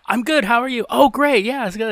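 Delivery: high, loud fake-cheery chat; mock friendly reply. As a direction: pointedly answer a greeting nobody gave. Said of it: "doing this, like".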